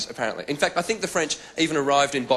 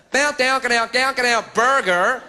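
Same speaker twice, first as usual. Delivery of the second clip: high, loud fake American noises; mock American gibberish; 10 semitones higher, 4 dB louder, slower than usual. Parisian mocking the American tourist in a loud fake American accent. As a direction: parody American English as babble. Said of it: "an American".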